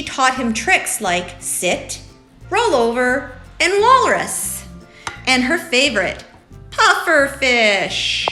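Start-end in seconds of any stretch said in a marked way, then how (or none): none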